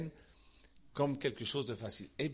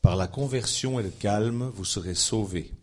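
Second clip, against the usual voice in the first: solemn scripture reading; 5 semitones lower, 6 dB louder than usual. Acted. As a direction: read it like holy text, deliberately. Reads scripture aloud.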